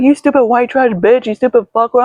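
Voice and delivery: high-pitched mock tirade; retold angry insult. Retelling a shouting match at the store, high angry insults.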